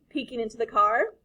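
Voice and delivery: ordinary delivery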